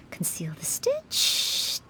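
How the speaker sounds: slow, hushed whisper as she sews; whisper to herself. Real, not acted. Maid whispering to herself while hiding a stitch, slow and hushed. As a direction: breathe the words to herself while stitching carefully. Quietly sewing the decoy bunny.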